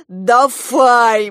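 "Дафай" said with f for v; imitated mispronunciation. A narrator's reading. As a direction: fondly mimic the child's "Дафай", f for v. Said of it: "как ты говоришь". (громко «Дафай», с «ф» вместо «в»)

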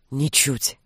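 low, soft murmur; intimate whisper. A narrator's reading. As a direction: breathe it close to her lips, low and hushed. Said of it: "прошептал он ей в губы".

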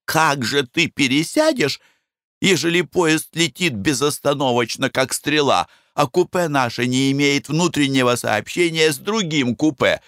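a nervous wife begging; anxious wife. Acted. Wife's voice.

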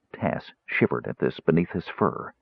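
ordinary delivery, nothing marked

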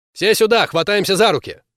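shouted call to the team; loud call. Acted. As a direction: call everyone over, loud and urgent. Calling the squad together.

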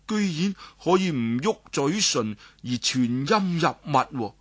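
ordinary delivery

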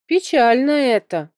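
a touch of sorrowful sympathy; sighing sadness. A narrator's reading. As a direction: say it as a mild, sad sigh. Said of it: "он вздохнул".

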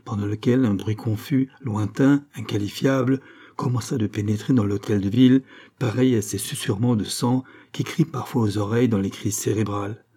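ordinary delivery

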